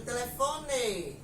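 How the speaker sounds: Paraná-style "telefone" with a full closed E at the end; mock Paraná accent, final E of "telefone" kept as E. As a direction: imitate Paraná speech, "telefone" ending in "ê". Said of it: "lá no Paraná, eles dizem realmente".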